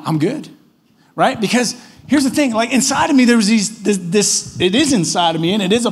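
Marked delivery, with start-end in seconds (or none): 0.0-0.5 low, subdued insistence; half-hearted, mildly glum reassurance; unconvincing fine. Retold or imitated. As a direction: insist weakly that all is well. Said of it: "You don't seem good".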